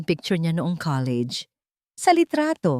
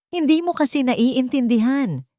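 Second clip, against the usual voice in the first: shout; 7 semitones higher, 3 dB louder than usual. Acted. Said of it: "sigaw ni Liro".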